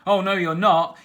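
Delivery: loud defiant yell; boy defying the dinosaur; yelled defiance. A narrator's reading. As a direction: fling it back at him. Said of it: "yelled Captain Flynn".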